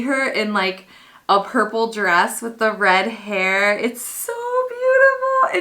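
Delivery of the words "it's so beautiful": high, tearful squeal of admiration; slow, crying with happiness; emotional. Real, not acted. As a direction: voice climbing high as happy tears come. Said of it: "I'm kind of an emotional human".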